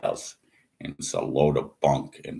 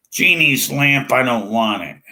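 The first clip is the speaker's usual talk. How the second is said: mocking; 7 semitones higher, 6 dB louder than usual. Mocking the idea of a genie.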